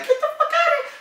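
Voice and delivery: high, laughing astonishment; retold disbelief. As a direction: squeal it in amused disbelief. Retold disbelief at shared hometown.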